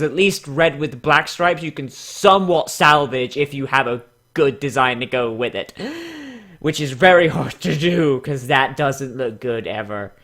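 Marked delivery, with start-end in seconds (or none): 5.8-6.4 quick high gasp; sharp gasp. Real, not acted. Reacting to bad designs.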